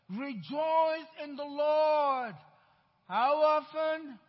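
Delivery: slow, loud, drawn-out preaching call; preached proclamation. Acted. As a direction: proclaim it to the congregation, stretching every word. Preacher proclaiming scripture call-and-response.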